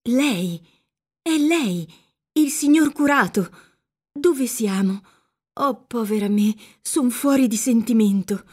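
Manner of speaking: gasped-out recognition, mildly acted; a dazed girl doubting her own senses; bewildered exclamation. A narrator's reading. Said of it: "e esclamò".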